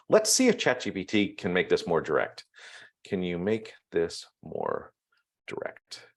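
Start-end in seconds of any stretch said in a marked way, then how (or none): 3.1-6.1 slow, soft, trailing into a near whisper; typing aloud. Real, not acted. Trainer types a ChatGPT prompt, slowly murmuring along. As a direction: murmur it slowly as you type.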